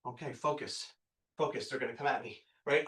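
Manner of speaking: low, soft talk to himself; steady yourself under your breath; quiet, inward pep talk; self-coaching. Retold or imitated.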